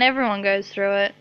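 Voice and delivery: ordinary talk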